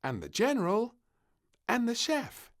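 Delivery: put-on high, loud little girl presenting her toys; the princess shows off her picnic guests; announcing. A narrator's reading. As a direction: proudly introduce each toy. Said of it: "she announced".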